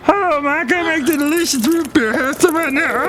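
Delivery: very high, squeaky put-on voice; comic root beer maker. Root beer maker offering a drink, very high character voice. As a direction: pitch it way up for a silly character. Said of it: "And look who else is here".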